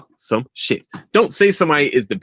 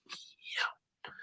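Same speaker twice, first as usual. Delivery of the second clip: whisper; 20 dB quieter than usual. Real, not acted. Aside whispered off-mic.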